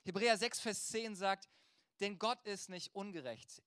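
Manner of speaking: ordinary delivery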